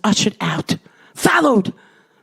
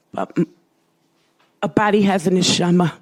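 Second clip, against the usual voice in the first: normal talking voice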